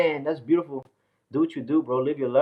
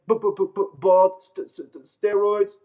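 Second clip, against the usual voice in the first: stammering each word start, mocking a hater; b-b-bald, st-st-steroids, hammed-up stutter; 3 semitones higher than usual. Streamer mocks chat hater with exaggerated stutter. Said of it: "saying I was stuttering".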